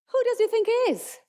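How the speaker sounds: high, sneering scoff; mocking critic. Critics scoffing at the young tennis player, high and sneering. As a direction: scoff at him, pitch way up.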